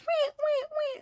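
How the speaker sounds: very high squeaky cheeping; bird cheeps. Retold or imitated. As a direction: chirp like a little bird. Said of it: "I can hear my birds".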